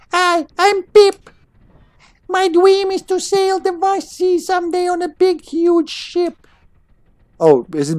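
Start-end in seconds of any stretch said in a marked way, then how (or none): 0.1-6.5 very high, squeaky little creature voice; slow, high-pitched tiny critter; Pip voice. Acted. Player voicing a little creature's dream, squeaky high. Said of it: "I need a good voice for him".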